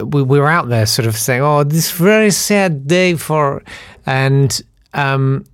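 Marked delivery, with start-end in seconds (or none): 1.4-3.6 slow, higher mock Ukrainian voice; fake Ukrainian accent. Pretend mourner out among the crowd, fake Ukrainian accent. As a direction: drag it out in a hammed-up Ukrainian accent. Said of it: "pretending to be Ukrainian".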